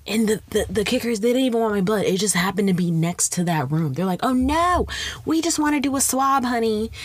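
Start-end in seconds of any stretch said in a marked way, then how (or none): 4.2-6.9 sweet nurse voice. Retold or imitated. Clinic staff voiced in story.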